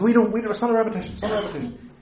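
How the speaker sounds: quick, high-pitched mock protest. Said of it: "He said".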